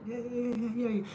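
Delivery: mock sugary nice-guy at the stop sign; smiling easygoing driver. Shown as a demonstration. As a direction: coo it like you mean no rush at all. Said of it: "Just be like".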